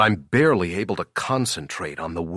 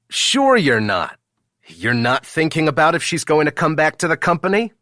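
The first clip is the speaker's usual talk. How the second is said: higher, louder, fast needling; mock him knowingly; teasing coworker; 8 semitones higher, 5 dB louder, faster than usual. Jackson needling Ethan about Sarah, high and fast.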